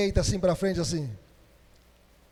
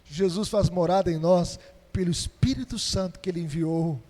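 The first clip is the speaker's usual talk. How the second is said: ordinary delivery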